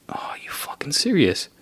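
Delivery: disbelieving whisper. Retold or imitated. Thinking to himself as the cop talks, whispered. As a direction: breathe it out under your breath. Inner reaction during the stop.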